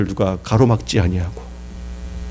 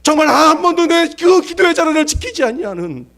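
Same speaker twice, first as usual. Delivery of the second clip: 높은 목소리로 힘차게 외치는 설교; 목청 높여 회중에게 호소하듯; 열정적인 설교. Acted. Preaching at full force. (힘찬 설교조)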